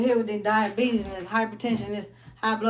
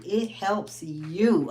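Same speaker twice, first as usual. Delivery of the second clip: 'it helps you' said slowly and deliberately; emphatic slow point; much slower than usual. Real, not acted. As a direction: lean on each word. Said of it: "I can't even say that enough".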